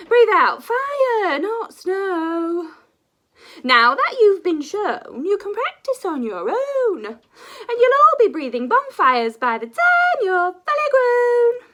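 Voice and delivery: high, loud and slow schoolmistress voice; loud teacher dragon. Acted. Teacher dragon booming instructions to her young class. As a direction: proclaim it like a grand dragon teacher.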